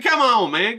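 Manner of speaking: mock-exasperated outburst; exasperated protest. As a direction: push back at a friend, voice jumping up. Retold protest to critical bartender friend.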